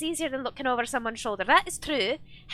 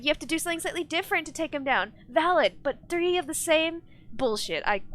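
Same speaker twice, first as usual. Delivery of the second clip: ordinary talk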